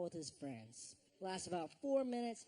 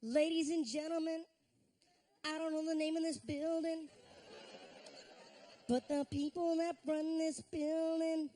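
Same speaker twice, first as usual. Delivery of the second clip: hamming up a rambling R&B star on the mic; R. Kelly impression; 3 semitones higher than usual. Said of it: "He talks like R. Kelly talks. So he goes like this".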